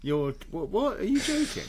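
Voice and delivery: high, astonished disbelief; surprised. Real, not acted. Stunned by repeated disaster.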